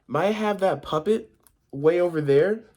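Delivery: put-on high-pitched storybook child's question; girl asking. A narrator's reading. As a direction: ask sweetly up high like a girl. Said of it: "asked another girl".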